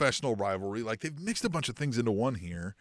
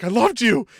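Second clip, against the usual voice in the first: loud, high, wailing I loved you; mock heartbroken cry; 9 semitones higher, 10 dB louder than usual. Heyman pleading with Roman, loud and wailing. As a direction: wail it like a jilted lover. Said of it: "He's like".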